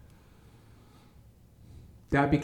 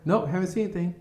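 high-pitched phone brush-off; offhand rejection; 7 semitones higher, faster than usual. Retold or imitated. Hiring office answering an applicant's call, high-pitched brush-off. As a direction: turn the caller down in a raised pitch.

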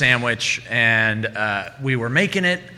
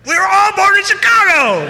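much higher, loud gleeful yell; triumphant shout; 6 dB louder than usual. Retold or imitated. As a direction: shout it like a winning punchline. Casey tops the customer's boast.

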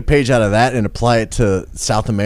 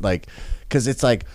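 usual speaking voice

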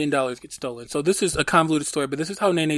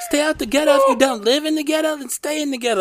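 much higher put-on grandma voice, lecturing; Nene's warning voice; 11 semitones higher, 4 dB louder than usual. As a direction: pitch it up like a granny laying down the law. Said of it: "She used to tell me".

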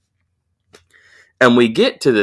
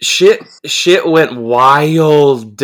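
drawn-out emphasis; 5 semitones higher, 4 dB louder, much slower than usual. Real, not acted. Dramatic telling of chaos.

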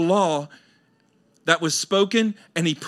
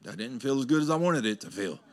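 deflated inner voice; 5 semitones lower, faster than usual. Retold or imitated. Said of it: "it's like".

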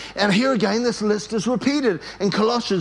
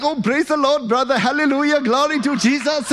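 put-on high, accented jubilant greeting; guy from church; 7 semitones higher than usual. Churchgoer waving at his pastor, high joyful praise. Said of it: "he's a guy from church".

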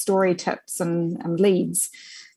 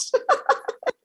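breathy laugh. Real, not acted. Laughing at her own blunder.

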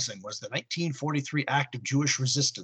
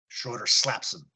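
ordinary delivery

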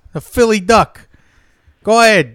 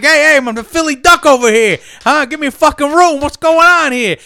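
higher, louder entitled demand; duck demanding; 7 semitones higher, 5 dB louder than usual. Acted. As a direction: throw his weight around. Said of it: "I'm like".

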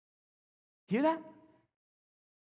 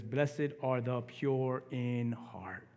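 slow quote; 3 semitones lower, 3 dB quieter, much slower than usual. Acted. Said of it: "He says".

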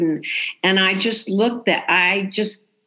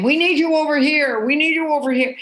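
higher-pitched, insistent demand; imitating pushy customers; 6 semitones higher than usual. Impatient customers pull at the waitress, mock insistent calling. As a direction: nag for service, same tune twice. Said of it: "not nice people pulling on me".

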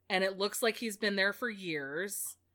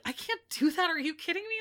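high, disbelieving protest; are you serious, pitched way up; mock outraged disbelief, much higher; incredulous refusal; 10 semitones higher, faster than usual. Blue refuses the ghost's request.

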